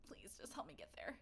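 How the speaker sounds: soft, pleading whisper; hushed pleading. Acted. Pleading softly with her servant to take her. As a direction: beg him quietly. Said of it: "she's just like".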